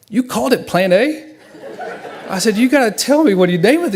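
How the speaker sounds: high and loud, half laughing in disbelief. Retold or imitated. Son teasing his dying dad about the plan's name, laughing. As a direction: laugh through tears at the name.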